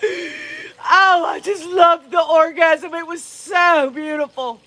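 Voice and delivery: high, hammed-up sobbing gush with a cry on the oh; mock gushing lover. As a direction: parody a tearful, swooning lover. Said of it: "they're both laying there going".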